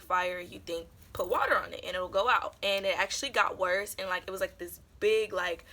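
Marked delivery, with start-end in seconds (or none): none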